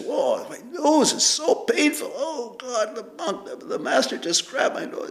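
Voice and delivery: high, whiny mock lament, half laughing; mock crying. Teacher voicing the crying monk back at the monastery, whiny mock sobbing. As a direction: whimper it like a sorry monk, not in earnest. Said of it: "and he's crying".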